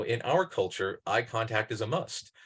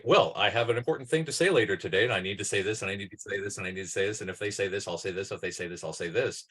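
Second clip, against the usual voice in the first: rehearsed scripting; 2 semitones lower, faster than usual. Acted. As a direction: rattle off a rehearsed plan on one note. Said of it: "we also do a lot of scripting".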